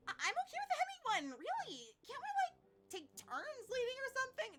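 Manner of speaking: high, loud, pleading interjection; anxious objection. Acted. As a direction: pipe up high, trying to break up a squabble. Nervous teammate interrupts the quarrel.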